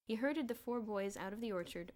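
plain reading voice

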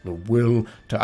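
low brooding villain monologue; Thanos voice. Retold or imitated. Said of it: "She came down while I was going like".

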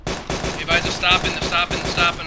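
loud, high urgent shouting; excited callouts. Real, not acted. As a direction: hype the squad into action. Live ambush callouts.

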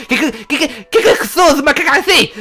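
high, loud, stammering dog voice; lisping dog boss. Acted. Dog boss petted by something unseen, stunned stammering lisp. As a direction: stutter it out in lisping shock. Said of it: "un feu sur la langue".

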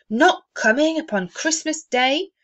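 disappointed disbelief. A narrator's reading. Bob asking his wife in disappointed disbelief. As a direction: sound crushed and disbelieving. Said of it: "Martha didn't like to see him disappointed".

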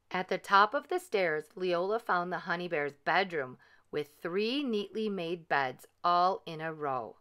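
ordinary delivery, nothing marked

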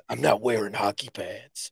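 low, soft vigilante line; low, muted Batman impression. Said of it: "some of the lines from Batman".

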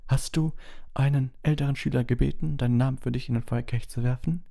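high-voiced, measured questioning; questioning headmaster. A narrator's reading. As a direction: press the boy with a question. Headmaster questions the boy again. (fragender Schulleiter, hohe Stimme)

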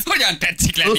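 high, put-on comic voice; high mock-polite questioning; silly greeting voice. Acted greeting to Böcsi néni.

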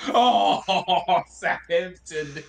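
laughing through the words; slow, incredulous, breaking into loud laughter; amused disbelief. Real, not acted. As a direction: repeat the jibe drawn out, cracking up. Laughs at friend's jibe about club.

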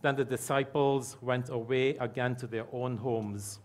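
ordinary talk, nothing marked

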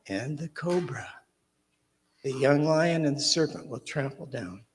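measured, reverent psalm reading; scripture reading. Acted. Reading the psalm to the children, reverent and measured.

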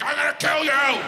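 high, loud mock threat; angry moose. Playing a real-life moose, loud mock threat to people. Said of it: "they're like".

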